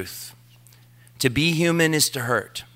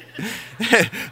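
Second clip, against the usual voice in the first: laugh; 9 semitones higher than usual. Real, not acted. Laughing at his own story.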